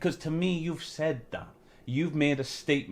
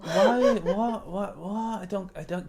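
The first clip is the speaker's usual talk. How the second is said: confused; 6 semitones higher, slower than usual. Retold or imitated. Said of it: "I'm like".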